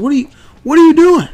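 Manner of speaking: high, loud startled yell; alarmed shout. Retold or imitated. Grabbing son, alarmed shout.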